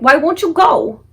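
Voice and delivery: slow, loud pleading; pleading cry. Acted. Sophie pleads with the BFG, loud. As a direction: press him for an answer. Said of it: "Sophie cried".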